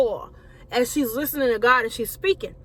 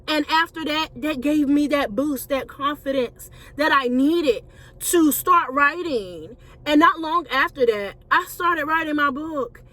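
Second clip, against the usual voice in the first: ordinary delivery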